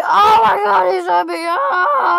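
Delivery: high, startled crying shout; shocked cry. Acted. God spots the briefcase robber and cries out. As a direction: gasp and shout in alarm.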